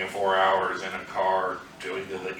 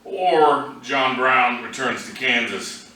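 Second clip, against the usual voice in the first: raised, projected poem recital; poetry reading; 5 semitones higher, 4 dB louder than usual. Acted. Poet reads a poem to an audience, projected recital voice. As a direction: read it out higher and louder than conversation, phrase by phrase.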